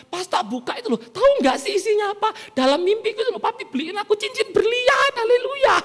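high-pitched, gushing storytelling; excited wife. Acted. Wife telling Papi her birthday dream, high and excited. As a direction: build to a squealed hint.

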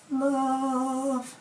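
"Love." lifted high and held. Shown as a demonstration. Showing a too-high love, sung up. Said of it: "that's a bit, bit high, innit?".

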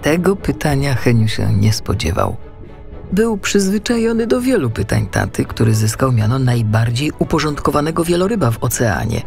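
plain reading voice

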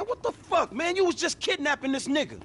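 high, incredulous protest; can't believe what he's seeing; shocked outburst. Acted. Sees his friends greet the captive, high shocked outburst.

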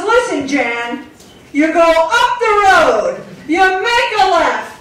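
dad giving directions, Jersey style; New Jersey dad. Retold or imitated. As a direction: bark the route like an angry Jersey dad. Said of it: "sounds like an angry response".

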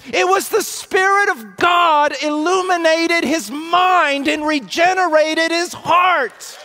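loud, high preaching shout; ringing pulpit shout, slowing on key words; full force preaching. Acted. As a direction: thunder it from the pulpit.

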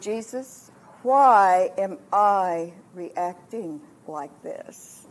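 drawn-out, searching prayer; retold prayer. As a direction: ask it slowly, word by word.

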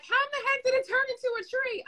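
high, fast amazed voice; amazed friend. Retold or imitated. Friend marvelling at the revived plant, high and fast. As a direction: squeal it like a stunned friend. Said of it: "they're like".

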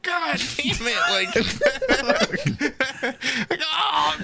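very high cursing through laughter; mock exasperation. Imitating couch buyer told to unload, mock exasperation. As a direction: imitate a man at wit's end, squealing high.

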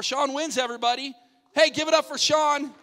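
loud, high celebratory call; shouted game-show victory call; excited winner announcement. Real, not acted. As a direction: whip up applause for the winner.

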